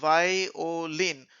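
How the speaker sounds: वायोलिन slowly split as vai-o-lin; teacher drawing out each syllable of "वायोलिन". Shown as a demonstration. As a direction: sound out वायोलिन part by part. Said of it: "दो वावेल मिला के आयो".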